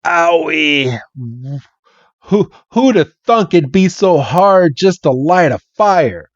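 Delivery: hammed-up Southern country fella; Southern accent. Bill grumbling while trying to light a fire, Southern accent. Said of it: "this Southern accent".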